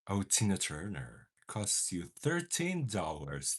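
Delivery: ordinary talk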